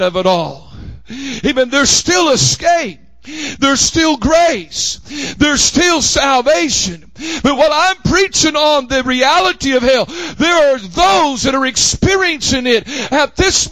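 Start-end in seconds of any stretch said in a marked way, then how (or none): none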